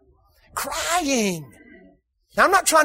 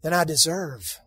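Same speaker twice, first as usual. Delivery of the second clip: much softer and lower humble answer; quiet content reply; 5 semitones lower, 7 dB quieter than usual. Shown as a demonstration. Preacher modelling a reply to a cashier, softly. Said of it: "just say this".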